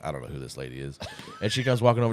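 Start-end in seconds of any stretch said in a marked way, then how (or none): 0.0-1.0 hushed, much softer aside; quiet. Retold or imitated. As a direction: mutter it low. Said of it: "I'm like".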